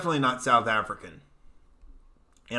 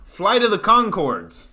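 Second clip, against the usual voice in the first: loud, high, drawn-out exclamation; excited; 6 semitones higher, 6 dB louder, slower than usual. Real, not acted. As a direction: blurt the name as it finally comes back. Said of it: "I got excited".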